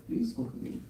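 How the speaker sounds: soft, hushed, coaxing "Please cook again." said sweetly; sweet-tongued plea. Retold or imitated. Vishwambar sweetly coaxing the sadhu, soft and charming. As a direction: coax him gently with "Please cook again.". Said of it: "he has a sweet tongue".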